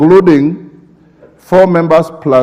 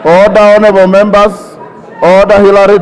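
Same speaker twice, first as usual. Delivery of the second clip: shouting order order; 4 semitones higher than usual. Real, not acted. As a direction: bark it out over a rowdy room. Speaker calling noisy House to order.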